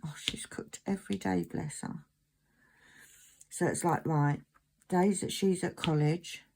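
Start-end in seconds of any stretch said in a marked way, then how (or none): 0.0-2.0 whispered, guilty aside to herself; soft unvoiced whisper of mum guilt. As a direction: breathe it out like a secret thought. Inner thought of mum guilt.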